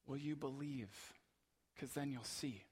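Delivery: Jesus softly inviting his disciples to trust; firm and gentle. Retold or imitated. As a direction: gently invite them to believe. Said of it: "He is firmly and gently saying".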